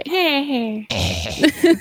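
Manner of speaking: put-on high babbling baby sounds. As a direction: babble like a baby who can't talk. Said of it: "That's the baby voice".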